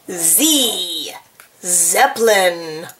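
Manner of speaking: usual speaking voice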